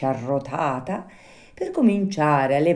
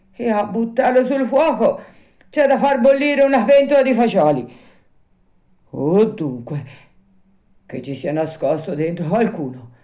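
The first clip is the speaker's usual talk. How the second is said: rising bluster; 6 semitones higher, 4 dB louder than usual. A narrator's reading. Carpenter working up bravado.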